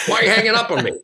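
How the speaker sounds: high-pitched mock indignation; hammed-up disbelief, speeding up; indignant caller. Retold caller protesting at hang-up.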